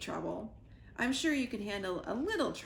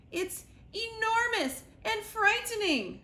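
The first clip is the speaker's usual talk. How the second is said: high, louder squirrel; squeaky and earnest; 12 semitones higher, 4 dB louder than usual. Acted. Squirrel trying better words for Sugar, high squeaky voice.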